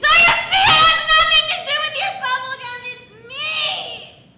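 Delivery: high, very loud bawl; shouting and crying. Acted. Child explaining herself to gum lady, shouting and crying. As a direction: wail it at full volume. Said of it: "So I'm shouting and crying".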